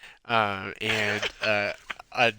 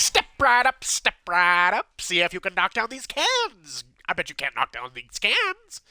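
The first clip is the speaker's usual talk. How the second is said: carnival barker; 7 semitones higher than usual. Acted. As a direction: hawk the game like a carnival barker. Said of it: "carnival barkers that are like".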